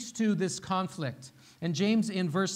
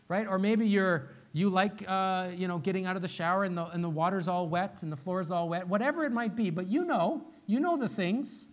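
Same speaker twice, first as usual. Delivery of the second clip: ordinary talk, nothing marked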